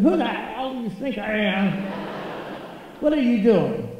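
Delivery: a hint of an outraged star. Friend [name] faking [name], caught out and indignant. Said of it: "talk like a girl".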